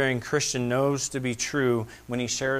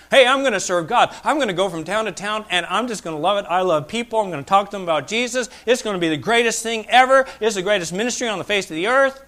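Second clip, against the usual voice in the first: higher, bright-eyed rush of enthusiasm; eager young Paul; 8 semitones higher, 6 dB louder, faster than usual. Retold or imitated. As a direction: sell the dream like a starry-eyed rookie. Paul's eager early hopes voiced.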